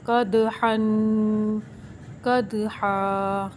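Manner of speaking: कद with a bouncing, echoed दाल, very slow; qalqala on दाल. Shown as a demonstration. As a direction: let the दाल in कद spring with a little echo. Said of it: "इधर हम दाल को हिलाएंगे".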